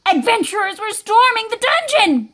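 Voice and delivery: high, excited announcement; alarmed narration. A narrator's reading. Storyteller announcing raiders in the dungeon, excited and high.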